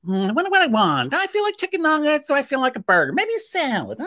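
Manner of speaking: mock an indecisive customer dawdling at the menu; dithering lady. Lady at the drive-thru pondering her order, high and dithering. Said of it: "At the sh".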